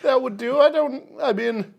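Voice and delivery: high, slow laughing excuse; sheepish Gary. Pretend. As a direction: stammer an excuse, half laughing. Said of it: "And Gary's just like".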